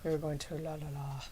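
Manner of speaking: mock low voice. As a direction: drop the voice low to mock him. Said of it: "he went lower with that one".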